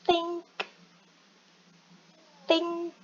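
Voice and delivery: "think" high, tongue lightly bitten for th; pronunciation demo. Shown as a demonstration. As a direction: model the th in "think". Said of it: "Lidahnya se-, sedikit digigit". ("think" dengan lidah sedikit digigit)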